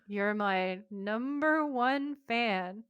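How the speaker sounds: very slow, drawn-out concession; reluctant agreement. Acted. Chuck humours his obsessive fan, reluctantly. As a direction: drag it out, humouring an unwanted admirer.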